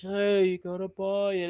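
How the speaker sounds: slow, soft mock-pitchman drone; monotone salesman. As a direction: imitate a lifeless sales plug, barely putting it out. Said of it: "He does that monotone ass voice".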